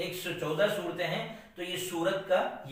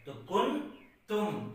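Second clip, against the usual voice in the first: ordinary delivery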